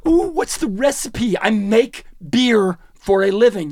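slow mock wonder, starting high; mocking imitation. Mocking brewers who fuss over recipes, slow parody. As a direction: parody them with a drawn-out high "ooh".

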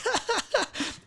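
high, soft giggle; re-enacted giggling. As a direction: imitate a helpless stoned giggle. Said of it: "I start giggling".

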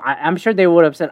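ordinary talk

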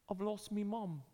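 put-on high-pitched little British boy; British accent. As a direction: imitate a lost small boy from the UK, British accent. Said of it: "he had this awesome accent".

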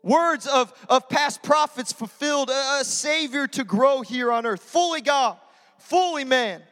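loud, driving altar-call preaching; preaching at full force. Acted. Pastor urging the congregation to accept Jesus, full-force preaching. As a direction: press the room hard toward a decision.

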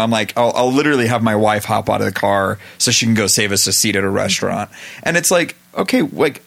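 normal talking voice

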